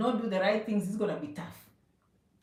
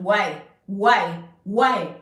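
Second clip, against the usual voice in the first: emphatic why; 5 semitones lower, 6 dB louder, much slower than usual. Real, not acted. Hammering a rhetorical question at viewers.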